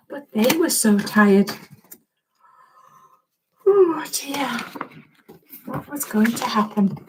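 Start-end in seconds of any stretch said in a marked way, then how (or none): 3.7-7.0 whispered, worried; hushed whisper. A narrator's reading. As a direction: breathe it out softly, worried. Narrator hushed aside to listeners.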